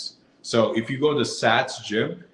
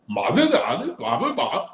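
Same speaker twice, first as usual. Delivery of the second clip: calling out; 8 semitones higher than usual. Retold or imitated. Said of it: "she called out to them in Danish".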